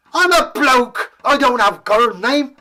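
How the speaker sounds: high, loud squeaky protest; outraged little man squawk; indignant dwarf. Acted. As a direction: bristle at the insult.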